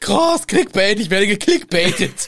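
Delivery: gespieltes Staunen. Pretend.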